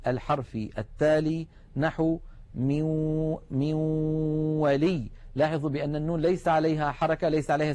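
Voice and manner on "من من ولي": slow tajweed demonstration of من ولي, the ن gliding into و; partial idgham recitation. Shown as a demonstration. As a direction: merge the ن of من into the و of ولي with a held nasal hum. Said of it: "علامة الإدغام الناقص".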